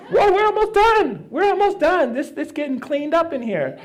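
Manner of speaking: high, excited mock cheer; excited helper. Clearing out the shadow's storage, high-pitched excitement. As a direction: squeal it like the job is nearly done.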